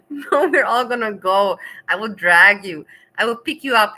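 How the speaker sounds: loud mock-bossy bluster; playful loud threat. Real, not acted. Streamer to Eggy, loud playful threat to drag him. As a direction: boom it out playfully.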